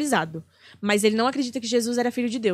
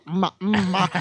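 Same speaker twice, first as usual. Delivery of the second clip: imitating Silvio Santos; 4 semitones lower than usual. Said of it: "Aí ele ficava".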